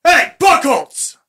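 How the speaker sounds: loud high shout across the lot; shouting after him. Acted. Lieutenant yells after departing sergeant, loud high shout. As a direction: yell his name as he drives off.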